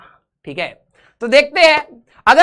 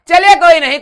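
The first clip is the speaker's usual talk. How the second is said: loud, high "चलिए कोई नहीं" with the stress on "चलिए"; cheerful call; 6 semitones higher, 8 dB louder than usual. Real, not acted. Teacher consoling students who are pretending to cry, bright loud call. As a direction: brush it off brightly and loudly.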